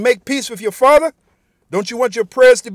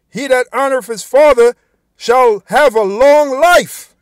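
preaching scripture; 3 semitones higher, 5 dB louder, slower than usual. Acted. Preacher reads scripture rising.

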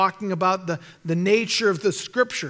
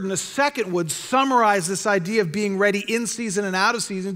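normal talking voice

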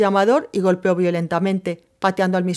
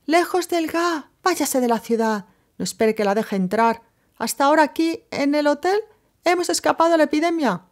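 woman shouting from afar; 8 semitones higher than usual. A narrator's reading. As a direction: call back from far across the garden. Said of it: "Se detuvo para gritar". (grito agudo desde lejos en el jardín)

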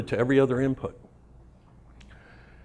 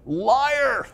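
shouting accusation; 12 semitones higher than usual. Retold or imitated. Calling out boss in meeting.

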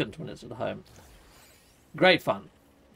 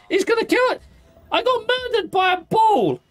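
much higher, louder disbelieving wail; exasperated squeal; 6 dB louder than usual. Real, not acted. Killed by a ball creature.

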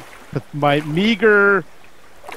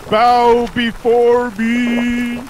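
slow, booming dark lord decree; 3 semitones higher, 4 dB louder, much slower than usual. Pretend. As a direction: proclaim it slowly like an overlord. Mock decree while dancing in game.

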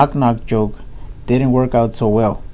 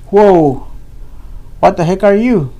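higher, louder startled outcry; 7 semitones higher, 4 dB louder than usual. Acted. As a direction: jump back at the sight of the costume. Cowboy sees scary costume.

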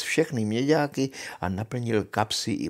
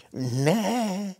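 high, drawn-out witch's refusal; witch, refusing; 8 semitones higher than usual. Acted. Witch answers the soldier. (odmítavá čarodějnice, vysokým hlasem)

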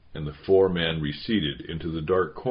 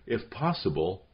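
plain reading voice

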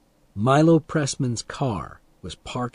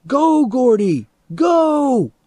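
high, loud, drawn-out cheer; cheering shout; 13 semitones higher, 6 dB louder, much slower than usual. A narrator's reading. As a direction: urge him on at the top of your voice. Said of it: "Vern shouted".

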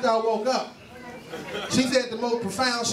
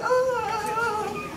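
high squeak; 12 semitones higher than usual. Retold or imitated. Squeal echoing the earlier voice.

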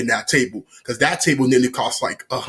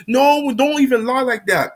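high-pitched scolding sister; mock indignant protest; 10 semitones higher, 3 dB louder than usual. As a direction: act out a sister objecting to a lie. Sister protests in acted quarrel.

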